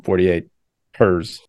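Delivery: "Hers" said in place of "Hours"; jokey mispronunciation. Shown as a demonstration. Host asking friend about the film, title jokily mispronounced. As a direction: read the abbreviation "Hers" as one syllable, like the pronoun.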